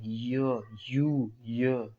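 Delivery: यू split into य, drawn out slowly; sounding out ya. Shown as a demonstration. Teacher sounding out य for the class. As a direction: stretch यू into a slow य sound. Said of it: "इसका उच्चारण तो देखिए य आ रहा है".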